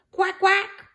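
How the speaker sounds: high, loud duck quack. A narrator's reading. A duck quacking behind Gumboot, a high, loud quack. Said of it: "A duck quacked behind him".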